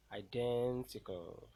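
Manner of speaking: Identical with DEN loud and drawn out; teacher's demo of i-DEN-tical; stressed syllable. Shown as a demonstration. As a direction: slowly lean hard on DEN in Identical. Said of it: "the one that will be pronounced louder".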